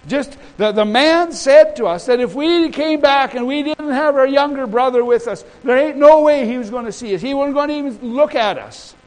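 high, loud, insistent objection; acted protest. Sons telling Dad the trip won't work, acted protest. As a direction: argue back as the exasperated son.

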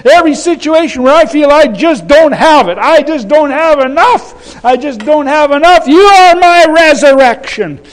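high, loud declaration building to a shout; fervent, high-pitched preacher's cry; impassioned prayer. Shown as a demonstration. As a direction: preach it out, piling up to a forceful peak. Preacher models impassioned prayer to congregation.

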